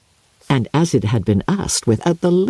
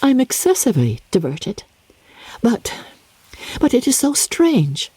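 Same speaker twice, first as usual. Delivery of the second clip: put-on higher, strained young woman's voice, hiding dismay; forced brightness; 9 semitones higher than usual. Elizabeth forcing amusement at her father's joke: higher strained brightness. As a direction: pitch it up as if amused but unsettled. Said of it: "cried Elizabeth".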